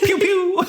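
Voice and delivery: high playful pew pew; gun sound effect. Retold or imitated. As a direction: mouth the shots, squeaky. Mimicking finger gun shots.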